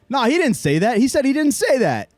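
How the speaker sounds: high, loud retold protest; mock indignant shout. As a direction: butt in loudly, imitating an indignant bystander. Man in line shouting in dispute.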